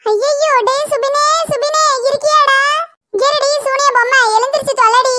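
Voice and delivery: high, worried calling out to her son; mother alarmed, calling her crushed son; anxious calling. Acted. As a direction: call out in alarm for your boy. Mother panics over crushed son.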